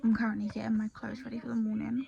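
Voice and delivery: quiet whisper with teeth strips; with whitening tray. Real, not acted. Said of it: "I've got whitening strips on".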